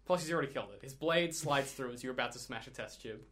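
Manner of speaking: ordinary talk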